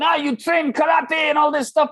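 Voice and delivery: mock shout in a thick Moroccan accent. Coach mocking Chris's karate, shouted Moroccan accent. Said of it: "I'm gonna imitate his accent".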